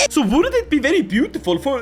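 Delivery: normal talking voice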